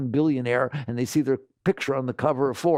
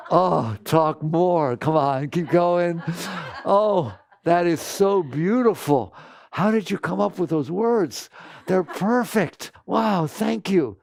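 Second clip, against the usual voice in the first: louder, higher, slow and gushing; eager delight; 4 semitones higher, 3 dB louder, slower than usual. Pretend. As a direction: beg them to keep going, thrilled. Mind loves hearing the insult.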